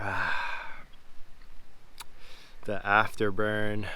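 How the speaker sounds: breathy, strained from wasabi burn; after eating wasabi. Real, not acted. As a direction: mouth burning, short pained breaths. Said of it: "I put too much wasabi in there".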